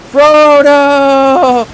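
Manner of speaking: high, loud, drawn-out name; slow-motion mock yell. Fan mocking the companions' reaction, slow-motion yell. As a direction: overdo the horrified slowed-down shout. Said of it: "in slow motion. Everyone's, in".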